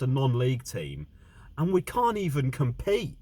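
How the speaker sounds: exasperated, loud and worked up; angry rant. Real, not acted. Fan ranting about team's loss.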